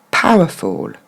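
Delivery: "Powerful" as PAH-wuh-fol, low falling end; correct English pronunciation. Shown as a demonstration. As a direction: stress "Pow", let "ful" sound like fol. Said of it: "bonne prononciation comme ça".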